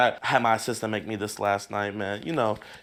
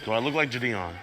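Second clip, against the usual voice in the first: ordinary talk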